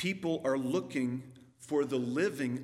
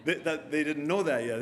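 laughing; 3 dB louder than usual. Real, not acted. Laughing after joke.

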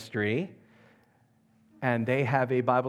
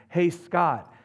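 calling out; 5 semitones higher, 5 dB louder than usual. Retold or imitated. Man calls his name from behind.

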